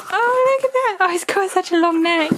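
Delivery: light up at the long neck; excited surprise. Real, not acted. Showing the husky's new toy, excited and high.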